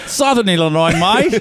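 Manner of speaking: hammed-up Australian mate; horrible Australian accent. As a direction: call it out in bad Australian. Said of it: "I do a horrible Australian accent".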